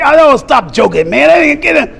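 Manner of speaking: high, loud incredulous protest; acted disbelief. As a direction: wave it off as a prank, pitch climbing.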